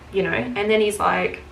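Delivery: ordinary delivery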